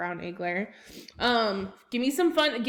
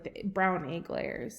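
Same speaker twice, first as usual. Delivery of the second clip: ordinary talk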